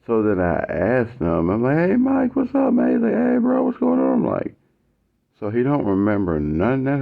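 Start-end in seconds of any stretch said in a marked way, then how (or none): 1.8-2.9 high, breezy hey-man greeting; upbeat greeting. Retold or imitated. [name] greeting his classmate Mike, high and breezy. 3.1-4.1 high, easy hey-bro voice; casual bro reply. Retold or imitated. Mike answering [name]'s greeting, high and casual.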